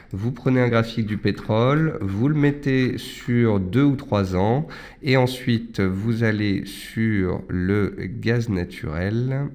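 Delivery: ordinary talk, nothing marked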